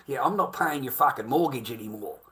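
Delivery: ordinary talk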